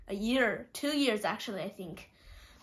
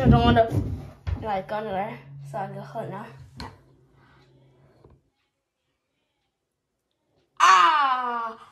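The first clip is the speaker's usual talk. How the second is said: talking while pulling floss; fingers in mouth; 7 dB louder than usual. Real, not acted. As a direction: lips held open by hand. Seen 4: Fingers hold floss inside mouth at the tooth throughout the words.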